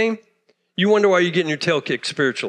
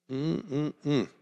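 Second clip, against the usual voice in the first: disapproving hum; 5 semitones lower, 12 dB quieter than usual. Real, not acted. Pastor's inner reaction.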